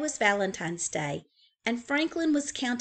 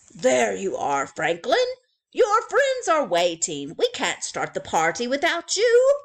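louder, kindly teacher voice; teacher owl; 4 semitones higher, 8 dB louder than usual. Acted. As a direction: coax him back gently but firmly. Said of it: "Mister Owl found Franklin".

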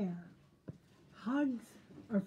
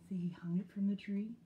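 ordinary delivery

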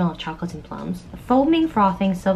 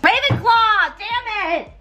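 exasperated outburst; 14 semitones higher, slower than usual. Real, not acted. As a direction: yell it high, fed up again. Quiz gives same result.

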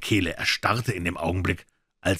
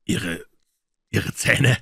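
stuttered; 2 semitones higher, 5 dB louder, much slower than usual. A narrator's reading. Gonzales startled by Stephen's teeth, stammering. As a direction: stumble over the first word, then louder. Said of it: "stotterte er".

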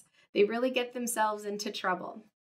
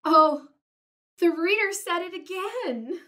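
loud high burst; delighted oh; 6 semitones higher, 7 dB louder than usual. Acted. Gerald hearing the reader, delighted oh. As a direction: gasp of glee.

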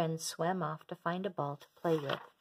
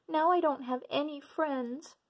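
put-on much higher, tearful child voice of a young remora; sobbing little girl; 9 semitones higher than usual. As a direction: sob it out like a small lonely fish. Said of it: "she sobbed".

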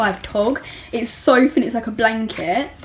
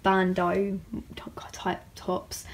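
whisper; 4 semitones lower, 11 dB quieter, much slower than usual. Real, not acted. Unsure listing of tops.

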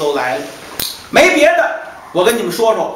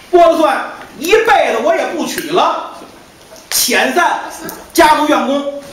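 resolute vow; 7 semitones higher than usual. Acted. Master tells the staff he will search for his wife, resolute. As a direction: swear he'll search till death.

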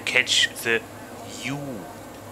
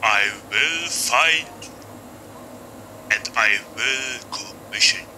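altered voice, slow; slow, processed voice test; voice changer; slower than usual. Shown as a demonstration. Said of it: "I hope this was better now".

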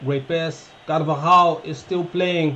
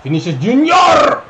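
goal call shout; 9 semitones higher, 9 dB louder than usual. Real, not acted. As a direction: scream it as the ball goes in. Goal being scored.

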